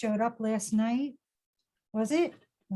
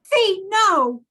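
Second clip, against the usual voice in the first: louder, higher urgent plea; 6 semitones higher, 6 dB louder than usual. Acted. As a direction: beg her loudly not to give in. Friend begs her not to confess.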